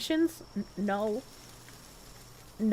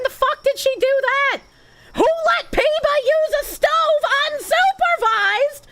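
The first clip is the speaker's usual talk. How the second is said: shrill-pitched hammed-up indignation; mock outrage; 11 semitones higher, 4 dB louder than usual. Streamer scolds chat about Peeba cooking, much higher, louder.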